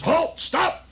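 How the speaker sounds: high, hammed-up shout; old-time cop yell. Narrator mocks the old police cry, hammed-up yell. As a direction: bark the command like an old movie cop.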